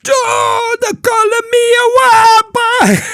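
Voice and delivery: high, loud, slow outraged shriek; furious shout. Retold or imitated. A boy screams at being called names, high furious shriek. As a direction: wail it high and loud like a kid losing it. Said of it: "He went".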